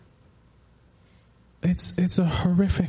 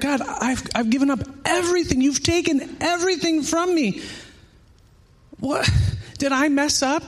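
higher, rising to a near-cry plea; tearful pleading prayer; 5 semitones higher than usual. Retold or imitated. Retelling a woman's tearful prayer, pleading higher. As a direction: retell her tearful wrestling with God, pitch climbing. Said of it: "she's in tears".

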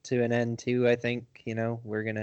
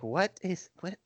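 puzzled; 9 semitones higher, 6 dB quieter than usual. Retold or imitated. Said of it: "I'm like".

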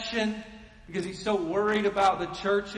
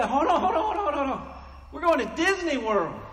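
coaxing parent; 8 semitones higher, 4 dB louder than usual. Retold or imitated. Parent coaxing kid away, laughing.

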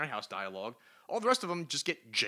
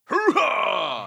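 war cry; 8 semitones higher, 13 dB louder than usual. Retold or imitated. End of acted war cry.

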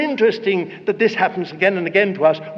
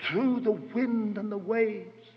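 ordinary delivery

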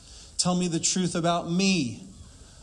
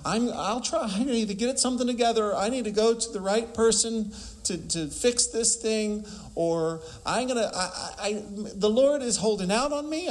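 higher, mock-anxious rambling, rising to the end; fretting believer; 5 semitones higher than usual. Preacher mimicking a struggling believer blaming God, higher and fretful. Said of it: "you go".

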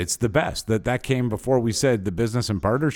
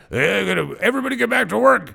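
much higher, bossy mock command; impression of Mike; 8 semitones higher, 4 dB louder than usual. Host does the boss voice on request, high bossy mock order. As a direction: bark it like a pompous boss, hammed-up. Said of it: "What was that voice earlier you did?".

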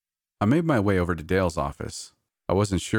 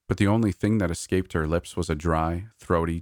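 usual speaking voice

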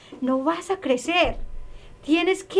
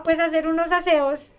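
ordinary delivery

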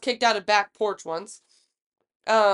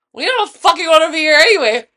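higher and louder, defiant; angry outburst; 6 semitones higher, 10 dB louder, faster than usual. Retold or imitated. Drunk friend angrily refusing after being kicked out. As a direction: spit it back at the bartender.

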